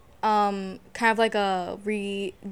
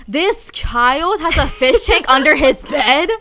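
slow, louder amazed question, breaking into a laugh; amazed disbelief; 4 semitones higher, 7 dB louder, slower than usual. Retold or imitated. Recalling a kids' makeover show, amazed. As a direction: marvel at it slowly with a laugh. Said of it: "I'm like".

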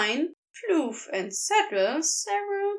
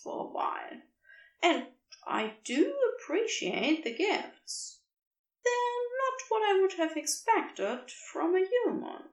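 softer, hushed cherub voice; 6 semitones higher, 3 dB quieter than usual. A narrator's reading. Aziraphale offering Crowley rest in the nest, hushed fond voice. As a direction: tell him gently he is safe here.

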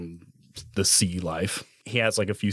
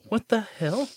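high-pitched bewildered exclamation; acted shock, pitch jumping up; incredulous; 12 semitones higher than usual. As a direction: blurt it out in disbelief. Acts out stunned reaction.

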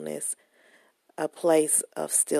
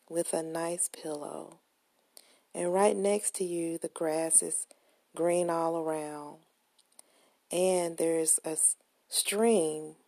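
guided meditation; 3 dB quieter than usual. Acted. Guided visualization, soft meditation voice.

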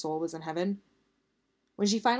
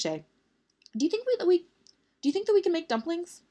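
hopeful question; 11 semitones higher, 3 dB louder than usual. Acted. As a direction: ask it up high, hoping for dumplings. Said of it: "Victoire questioned".

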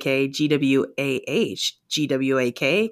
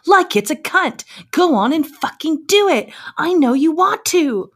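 high-pitched, insistent demand; acted exasperated outcry, pitched high; screamed in thick accent; 8 semitones higher than usual. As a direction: urge them on in a much higher voice. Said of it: "She screamed at her in her thick accent".

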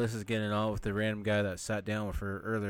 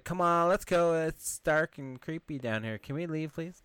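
higher-pitched imitation of an uneasy girl; mock nervous pleading; 6 semitones higher, slower than usual. Girl begs to leave the dark crypt, mock nervous. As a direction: plead lightly to get out.

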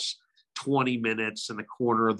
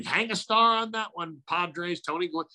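Jerry Coleman call; 8 semitones higher than usual. Retold or imitated. Jerry Coleman catchphrase imitation.

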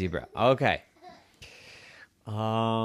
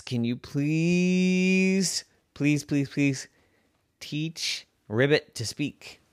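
slow begging; 3 semitones higher, slower than usual. Acted. Pig begging repeatedly.

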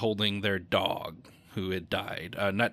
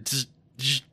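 buzzing zap noise; bug zapper buzz; 3 semitones higher than usual. Retold or imitated. He imitates the backyard bug zapper with a buzzing zap.

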